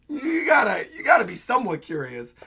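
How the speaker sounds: yelling in disbelief at the page; frustrated shout. Real, not acted. Exasperated outburst at Luffy.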